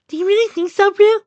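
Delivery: put-on very high, loud girlish voice; high-pitched character voice asking for reassurance; Duck, unsure. A narrator's reading. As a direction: squeak the question out, unsure. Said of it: "her voice unsure".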